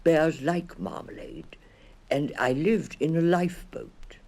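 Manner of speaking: lightly voiced little bear. A narrator's reading. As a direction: tell it like a small bear's secret. Bear continues speaking.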